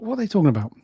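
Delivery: quick, high, lighter puzzled question; baffled thought. Retold or imitated. Recalling a first thought about the lightsabers, baffled. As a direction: toss it off fast and high.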